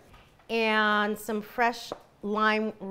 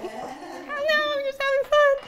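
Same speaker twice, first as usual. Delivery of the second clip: laughing; 13 semitones higher than usual. Real, not acted. Laughing at being teased.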